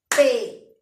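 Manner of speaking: pelota split loudly as pe-lo-ta, one clap per syllable; clapped syllables. Shown as a demonstration. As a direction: beat out pelota in three claps. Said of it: "pelota tendrá tres sílabas". (pelota silabeada fuerte con palmadas)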